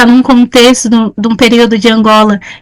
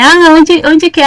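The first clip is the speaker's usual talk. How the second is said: higher, puzzled stranger asking the way; asking directions; 6 semitones higher than usual. Pretend. Teacher acting a lost visitor asking a Minas local the way, higher. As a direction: play a lost traveller asking where it is.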